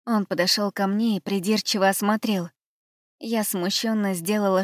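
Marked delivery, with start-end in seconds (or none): none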